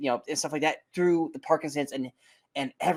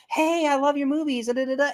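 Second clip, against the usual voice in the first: high-pitched imitating an excited fan; excited fan girl; 12 semitones higher than usual. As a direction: mimic a gushing young fan, pitched up. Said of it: "She's like".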